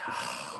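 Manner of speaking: long, soft tired sigh; weary sigh. Real, not acted. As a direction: sigh like the day is heavy.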